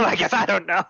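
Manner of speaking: ordinary talk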